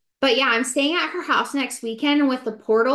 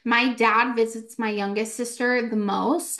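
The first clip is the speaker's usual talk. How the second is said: ordinary talk, nothing marked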